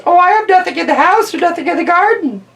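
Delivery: high, mocking old lady excuse. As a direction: squeak it out to mock her.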